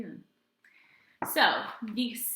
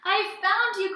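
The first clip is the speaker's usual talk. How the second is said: high, loud delighted cry; joyful discovery; happy cry; 11 semitones higher, 10 dB louder, slower than usual. Acted. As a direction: grin through it. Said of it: "cried George with a big happy grin".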